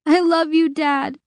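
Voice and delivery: high, broken love words through sobs; between sobs. A narrator's reading. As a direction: cry it out as you're dragged off. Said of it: "she said in between sobs".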